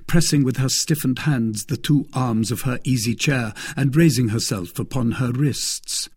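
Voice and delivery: ordinary delivery, nothing marked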